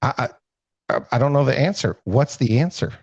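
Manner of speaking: a flustered man demanding the answer; frustrated. Retold or imitated. Professor stumped by the farmer's riddle, frustrated stammer. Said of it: "He's frustrated".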